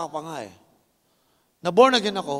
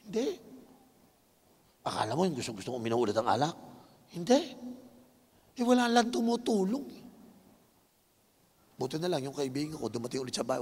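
normal talking voice

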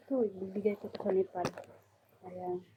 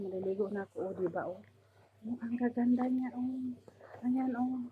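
ordinary talk

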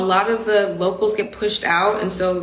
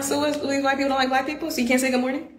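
higher-pitched, put-on indignant question; mock offended; 5 semitones higher, 3 dB quieter, faster than usual. Southerner recalling taking the missing good morning personally, mock offended. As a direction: voice an affronted inner thought, pitched up. Voicing her offended first reaction.

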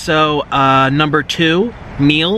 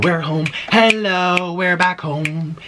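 normal talking voice

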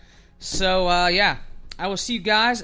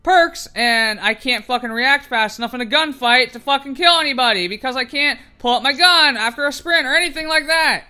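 loud, fast frustrated rant; real exasperated complaining; pissed off; 3 semitones higher, 4 dB louder than usual. Real, not acted. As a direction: rattle off the grievance with rising heat. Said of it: "how absolutely fucking pissed I am".

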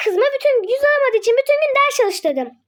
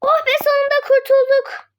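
ordinary talk, nothing marked

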